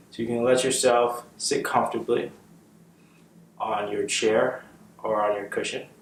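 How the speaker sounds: calm, unhurried meditation guide with long pauses; measured, settled voice for leading meditation; guided meditation. Real, not acted. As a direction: guide them gently into the breath, leaving space after each phrase.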